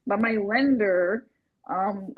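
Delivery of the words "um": usual speaking voice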